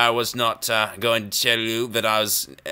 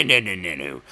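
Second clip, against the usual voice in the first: normal talking voice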